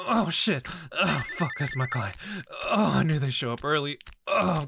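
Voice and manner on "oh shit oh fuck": startled. Acted. Client arrives unexpectedly.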